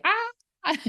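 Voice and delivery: high startled little gasp; mock panic. Reading symptoms online, a mock panicked yelp. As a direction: squeak like a sudden scare.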